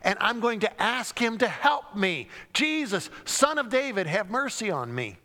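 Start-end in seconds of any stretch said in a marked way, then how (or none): 2.5-5.2 high pleading call for mercy; a beggar calling out in hope; desperate cry. Acted. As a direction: cry out to be heard over a crowd. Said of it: "Bartimaeus is probably hopeful".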